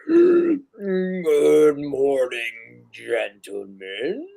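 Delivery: a big swooping creature welcome; NPC greeting. Acted. Two Trunks greets the party.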